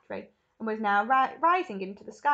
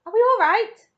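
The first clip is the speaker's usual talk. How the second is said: high, loud, worried question; anxious cry; 12 semitones higher, 13 dB louder than usual. A narrator's reading. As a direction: cry out for reassurance. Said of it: "cried Grandpa Joe".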